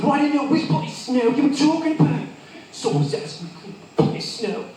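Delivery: high, defensive British reply; British accent. Acted. Worker answers the boss, high British accent. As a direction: argue back in British. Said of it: "an excuse for me to do an accent".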